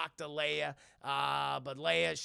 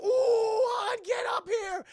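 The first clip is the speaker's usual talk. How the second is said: high, loud mock-panicked cry; alarmed calling out; 9 dB louder, slower than usual. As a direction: shriek for help, hammed up. Leia calls Han in alarm.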